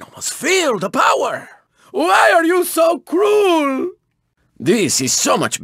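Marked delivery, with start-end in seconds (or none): 1.9-3.9 high, drawn-out theatrical wail; melodramatic lament. Acted. Racer wailing at a rival's attack, melodramatic and high. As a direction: plead slowly and high, hamming it up.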